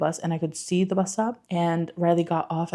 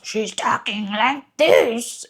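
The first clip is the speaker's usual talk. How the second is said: slow, louder put-on grouchy monster voice; mock growly dragon, a bit higher and louder; Oscar the Grouch dragon; 4 semitones higher, 5 dB louder, much slower than usual. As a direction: drag it out like a grumpy puppet monster. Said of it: "made her sound like Oscar the Grouch".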